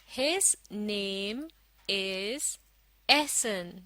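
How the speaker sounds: very slow, word by word; slow model reading. Shown as a demonstration. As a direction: read it out slowly for learners to follow. Model reading for learners to follow. (धीरे-धीरे, एक-एक शब्द पढ़कर)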